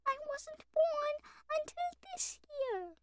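slow, soft, very high lamb voice; pleading lamb. A narrator's reading. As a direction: plead softly in a tiny high voice. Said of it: "pleaded the lamb".

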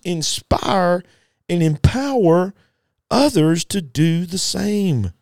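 high-pitched, driving sermon climax; fervent preaching. Real, not acted.

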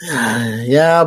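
usual speaking voice

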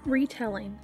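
plain reading voice

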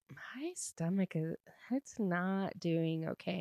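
very soft, whispered stomach complaint; acted hushed admission of feeling ill; queasy half-whisper. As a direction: whisper it like an imitated sick man. Retelling character with upset stomach.

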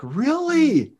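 high, slow, drawn-out "really?"; amazed. Retold or imitated. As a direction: stretch it out in astonished disbelief. Amazed reaction to God.

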